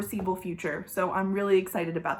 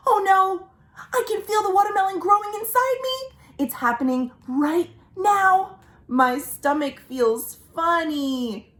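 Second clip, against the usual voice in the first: worried crocodile; 8 semitones higher than usual. Acted. Croc feeling the watermelon growing, high anxious fretting. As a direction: fret in growing alarm.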